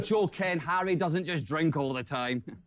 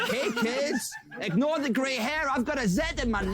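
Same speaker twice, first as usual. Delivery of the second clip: much higher, hammed-up cool guy; mock trendy dad; 7 semitones higher than usual. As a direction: talk down to kids like a try-hard. Mocking a thirty-something's cool name.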